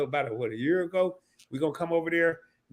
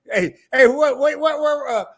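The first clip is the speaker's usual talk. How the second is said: excited; 10 semitones higher, 7 dB louder than usual. Real, not acted. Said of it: "still hyped after impression".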